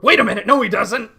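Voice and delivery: loud, high sudden correction; sudden realization. Retold or imitated. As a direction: catch your own mistake. Retold self-correction, loud.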